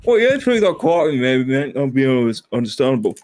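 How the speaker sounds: hammed-up British accent settling down. Host switching versions of British accents, British accent. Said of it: "different versions of British accents".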